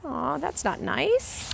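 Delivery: hushed, slow and gentle; soft sympathy. Real, not acted. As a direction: sigh it out kindly. Reader comments to child audience.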